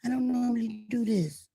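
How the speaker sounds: soft mock-timid reluctance; hushed, hesitant mock protest; scared sheepish. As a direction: quietly imitate a nervous little sheep. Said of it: "he was getting scared like a little sheep".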